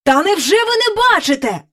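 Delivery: high, excited royal cry; agitated exclamation. A narrator's reading. King cannot believe courtiers miss it, agitated. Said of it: "так розхвилювавшись".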